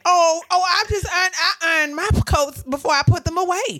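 high, loud mock-prim voice; imitating Rhonda. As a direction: play her proud of ironing. Rhonda quoted.

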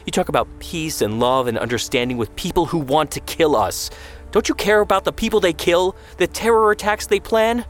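spat angry protest. A narrator's reading. Son challenging his mother's values, increasingly heated. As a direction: build from accusation to high, heated challenge. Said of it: "Aryeh spat".

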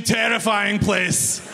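ordinary talk, nothing marked

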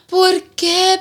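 high, loud mock sob; mock crying mom. Crying mom asking her daughter why, high mock wail. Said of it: "She's like".

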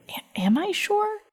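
high, slow, half-whispered question; small, uncertain voice climbing at the end; hesitant self-doubt. Retold or imitated. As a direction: falter into a high, hushed question to yourself. Retold inner doubt during the grilling.

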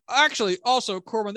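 ordinary delivery

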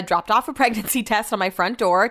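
ordinary delivery

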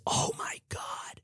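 slow, breathless whisper; awed whisper. Acted. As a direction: whisper it in disbelief. Kim awed by the pitch.

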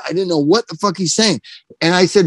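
ordinary talk

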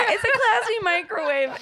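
laughing high and loud. Real, not acted. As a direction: laugh the words out. Laughing echo of joke.

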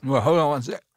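choking a little on apple juice; strained, about to cough; juice down the wrong pipe. Real, not acted. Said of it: "had apple juice go down the wrong pipe".

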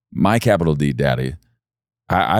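ordinary talk

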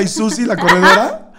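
ordinary talk, nothing marked

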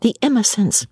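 high, urgent shushing; anxious, high-pitched hush. A narrator's reading. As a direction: warn a friend that others may overhear. Companion afraid of being overheard.

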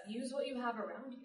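normal talking voice

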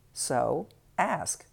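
ordinary talk, nothing marked

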